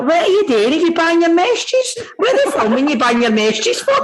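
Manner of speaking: nosy gran asking why they're filming; granny quizzing. Retold or imitated. Granny asks why he's being filmed, high and nosy. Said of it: "I was like".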